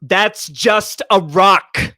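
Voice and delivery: slow, loud, high shouted Malkovich; bad acting. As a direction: drag it out and yell it. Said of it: "He doesn't deliver it like that".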